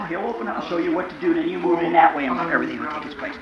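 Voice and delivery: ordinary delivery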